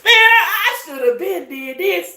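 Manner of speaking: high, loud, slow laughing wail; laughing lament. Pretend. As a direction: laugh and cry it out in mock regret. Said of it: "You're gonna be like".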